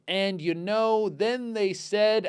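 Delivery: very slow, laboured reading aloud; slow halting reading. Retold or imitated. Imitating Bill Burr reading write-ins, slow halting delivery. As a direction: read word by word like a struggling fourth grader. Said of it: "You're doing it slow like me".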